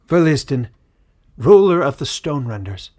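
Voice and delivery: a leader decreeing to his whole people; proclaiming elder. A narrator's reading. Feralus formally addresses the queen.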